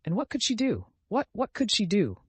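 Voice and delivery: high, pressing persistence; hot and excited. A narrator's reading. Sonia defends her stepmother to Raskolnikov, heated and high. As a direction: press the question, getting heated. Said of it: "She persisted, getting hot and excited".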